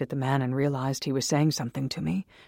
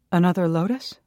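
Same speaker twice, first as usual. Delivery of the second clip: eager; 4 semitones higher, 6 dB louder than usual. Acted. Narrator's eager self.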